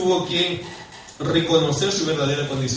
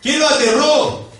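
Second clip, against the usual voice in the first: high, loud, urgent questions; fervent pulpit shouting; impassioned preaching; 10 semitones higher, 8 dB louder than usual. Real, not acted. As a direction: drive each question home at full force. Preacher dramatizes Isaiah's terror.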